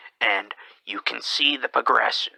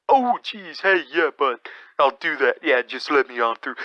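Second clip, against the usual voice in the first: Canadian accent, mimicked, starting much higher and easing down; 4 semitones higher than usual. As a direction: do a sing-song Canadian accent with an "oh geez" lilt. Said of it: "So they get that accent of".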